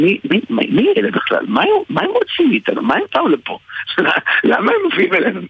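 high, puzzled mock voice; baffled audience voice. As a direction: squeak out the confusion. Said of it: "ואומרים".

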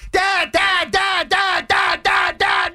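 put-on high, insistent kid's voice; pestering high-pitched calls; child calling Dad. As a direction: imitate a child nagging through the door, high and repetitive. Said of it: "then I get constant knocking".

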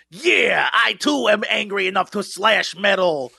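gruff shouted YouTuber voice. Pretend. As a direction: growl it like an angsty edgy YouTuber. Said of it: "this is [name]'s YouTuber voice".

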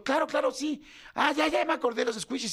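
exclamación aguda de reconocimiento; recordar de golpe. Retold or imitated. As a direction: cae en la cuenta, con tono más alto. Finge acordarse de pronto. (acordándose de repente, con entusiasmo)

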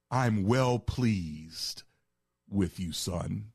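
slow, deliberate fatherly blessing; God the Father voiced. Preacher voicing the Father's actual words to Jesus, slow and solemn. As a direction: draw out well pleased like a solemn benediction. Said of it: "he used a different word".

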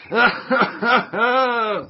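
high, gleeful villain cackle; evil cackle. Acted. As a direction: cackle like a gloating germ. Germ gloats over Willy.

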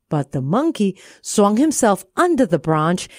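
ordinary delivery

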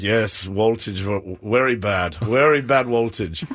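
slow, low Russian repairman imitation; grave Russian verdict, every V said as W; Russian accent. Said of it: "but they say voltage".

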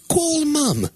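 high put-on British voice for the phone; British accent. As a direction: say it posh so the phone understands. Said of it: "speak in a British accent".

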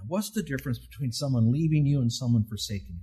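ordinary delivery

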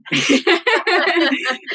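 bright burst of laughter; amused laugh. Real, not acted. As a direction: laugh it off warmly. Laughs at LinkedIn joke.

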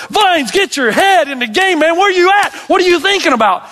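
mock coach yelling, high and loud; a hint of a scolding basketball coach; coach chewing out. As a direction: bark like an annoyed coach.

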